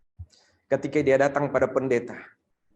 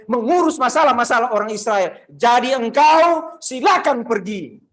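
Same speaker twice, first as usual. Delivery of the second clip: stern rebuke; 8 semitones higher, 7 dB louder than usual. Acted. Jesus rejects the pleading mother in a loud stern rebuke. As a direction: drive her away harder with each line.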